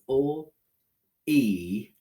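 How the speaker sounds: O-E-Lee as drawn-out L, then EE, then LEE; slow sounding out. Shown as a demonstration. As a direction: stretch the sounds of O-E-Lee apart, L, EE, LEE. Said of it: "let's do the second syllable".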